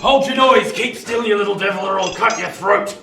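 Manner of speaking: loud, high snarling threats; fierce threat. Acted. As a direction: roar at the boy to scare him. Convict seizes boy in churchyard.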